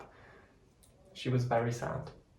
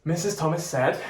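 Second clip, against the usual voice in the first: usual speaking voice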